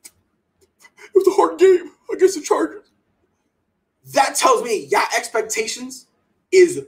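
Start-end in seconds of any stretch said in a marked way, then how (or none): 0.0-2.9 mock player. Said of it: "and be like".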